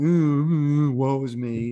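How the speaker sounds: put-on sulky child's whine; whiny kid. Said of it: "this kid is like".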